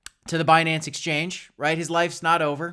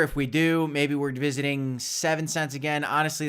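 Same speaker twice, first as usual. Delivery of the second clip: ordinary delivery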